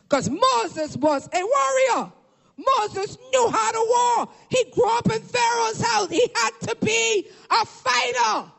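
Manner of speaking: high, shouted sermon cadence; impassioned pulpit shout; full-force preaching. Acted. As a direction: preach it like a rallying battle cry.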